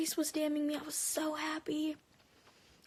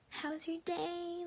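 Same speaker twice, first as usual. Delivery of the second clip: high, soft, very drawn-out mimicry; slow, higher-pitched mock small talk; mocking imitation; 4 semitones higher, 5 dB quieter, much slower than usual. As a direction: stretch it out to mock his awkward small talk. Mocking his awkward small talk on call.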